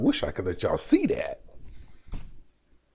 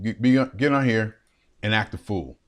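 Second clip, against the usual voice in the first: normal talking voice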